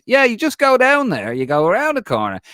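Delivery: put-on loud, high-pitched thick Dublin accent; exaggerated Dublin Irish, called out; thickest Dublin accent. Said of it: "in the thickest Dublin accent".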